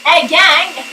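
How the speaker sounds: loud mock-monitor rallying call; camp monitor. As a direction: call the group like a peppy instructor. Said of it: "C'est la monitrice de quinze jours". (monitrice qui interpelle sa gang)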